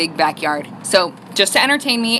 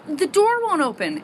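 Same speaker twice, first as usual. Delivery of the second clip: put-on puzzled young daughter; 6 semitones higher, 3 dB quieter, slower than usual. Mother voices puzzled young daughter.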